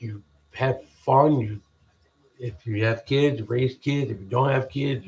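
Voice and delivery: normal talking voice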